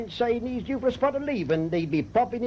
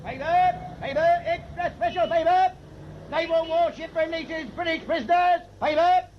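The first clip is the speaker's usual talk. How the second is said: high, ringing news vendor's call; street paper cry; 9 semitones higher than usual. Acted. News vendor hawking papers to passers-by, calling out. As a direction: sell papers to the passing street.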